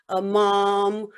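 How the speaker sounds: normal talking voice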